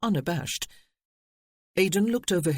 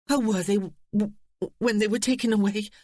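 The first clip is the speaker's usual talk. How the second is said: shaking voice; 5 semitones higher than usual. A narrator's reading. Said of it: "Eve's voice was shaking".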